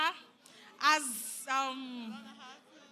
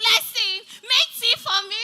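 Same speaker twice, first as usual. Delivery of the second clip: higher, louder mock boss ordering tea; mock boss voice giving an order; 7 semitones higher, 6 dB louder than usual. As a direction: play the boss bossing Blessing around, pitched up. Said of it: "Oga once said".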